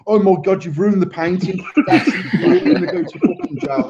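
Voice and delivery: high-pitched scolding, climbing at the end; mock horrified classmate. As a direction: imitate a classmate panicking over the damage. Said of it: "probably someone like you, Chris, like, going".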